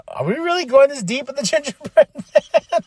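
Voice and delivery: can't believe what he's seeing; incredulous. Retold or imitated. Fan asking himself about the gingerbread man, in disbelief.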